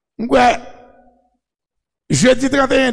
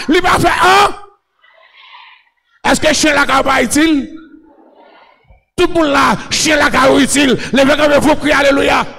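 shouted, driving sermon in short bursts; full-force preaching; 4 semitones higher than usual. Real, not acted. Preacher rousing the crowd to cry alléluia, full-force preaching. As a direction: fire each phrase out at the congregation.

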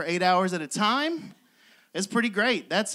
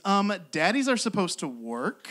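ordinary delivery

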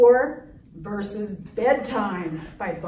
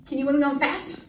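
usual speaking voice

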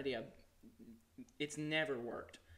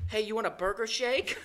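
mock flirty line, slower and pitched up; botched pickup line; 7 semitones higher, 9 dB louder, slower than usual. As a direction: garble the line like a nervous flirt. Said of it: "he said".

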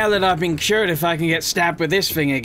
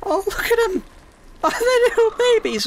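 much higher, excited cooing voice. Real, not acted. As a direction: gush over the hatchlings like a doting parent. Cooing over newly hatched babies.

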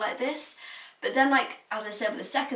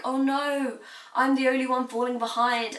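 higher, lightly dramatised dismay; mock stressed worry; 4 semitones higher than usual. Student fretting to herself when stressed, mock worried. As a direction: voice a worried thought, pitched up. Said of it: "Sometimes when I'm stressed".

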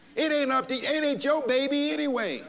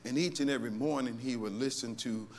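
much softer, lower storytelling; quiet closing; 10 semitones lower, 8 dB quieter than usual. Real, not acted. Pastor winding down the sermon, softer and lower for a story. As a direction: bring it down to a soft, low story.

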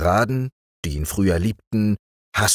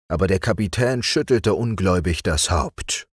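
ordinary delivery, nothing marked

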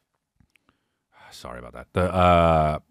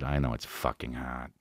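muttered low and much softer; under his breath; 11 semitones lower, 11 dB quieter than usual. Retold or imitated. Old pizza guy complaining about his wife, muttered low. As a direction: grumble it quietly aside. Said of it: "under his breath".

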